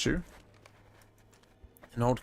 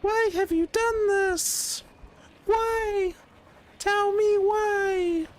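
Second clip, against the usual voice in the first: very high squeaky indignant voice; shrill high-pitched protest; Lareth high voice; 4 dB louder, much slower than usual. Acted. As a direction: squeal like a wronged little lord. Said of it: "different voice for Lareth".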